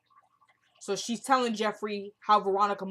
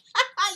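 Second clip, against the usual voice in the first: very high, loud put-on cackle; mock scoffing laugh; 11 semitones higher, 6 dB louder than usual. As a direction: imitate a mother laughing it off. Acts out mother's scoffing laugh.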